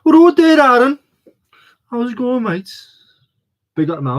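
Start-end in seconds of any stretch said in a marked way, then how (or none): 0.0-2.7 high, loud mock Brummie call; hammed-up Birmingham greeting, pitched way up; Birmingham accent. Streamer greeting a mate in a mock accent, hammed-up Brummie. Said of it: "some sort of racist Birmingham accent".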